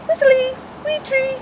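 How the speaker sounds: very high whistled birdsong; high whistly starling imitation; starling song. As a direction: sing it like a starling on a pole. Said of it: "Starling sings from a metal pole".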